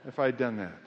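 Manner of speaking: usual speaking voice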